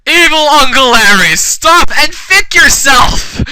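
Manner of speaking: high, loud hammed-up challenge; angry yell. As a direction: melodramatic hero's shout. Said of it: "Crep yelled really angry".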